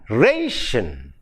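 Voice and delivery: "ration" with a long, stressed "ra"; RAY-shun, weight up front, for "ration"; stress on first syllable. Shown as a demonstration. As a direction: stretch the "ra" in "ration". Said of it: "so the stress falls on the penultimate".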